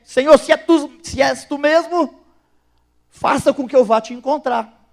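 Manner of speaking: high, loud eager plea shouted across the water; excited call. Retold or imitated. Peter calling to Jesus from the boat, high and loud. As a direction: cry out to the Lord in a burst of faith.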